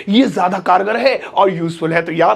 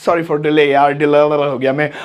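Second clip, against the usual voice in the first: mock casual apology; 8 semitones lower than usual. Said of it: "फिर मैंने बोलना सीखा".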